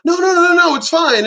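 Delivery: high-pitched, loud imitation of reassurance; mock-reassuring high voice, quick string of noes; breezy dismissal. Doctor brushing off worry, acted.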